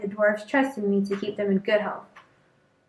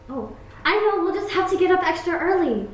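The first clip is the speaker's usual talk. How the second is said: higher, bright chirpy princess voice; cheerful princess; 7 semitones higher than usual. Acted. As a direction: gush like a delighted princess.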